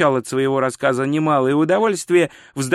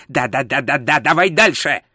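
testy interruption, rising at the end; irritated hurrying. Acted. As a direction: cut in impatiently and hurry them along. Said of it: "раздражённо поторопил его Траймон".

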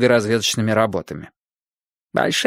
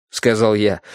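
ordinary delivery

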